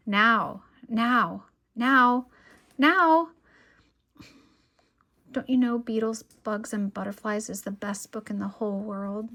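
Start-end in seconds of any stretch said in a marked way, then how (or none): 0.1-3.3 building from firm to loud high insistence; each now louder, like a child stamping her feet; insistent demand. Acted. Lola insisting, getting louder.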